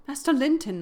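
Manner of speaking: loud, high call; crying out. Acted. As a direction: cry out his name. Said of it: "I cried".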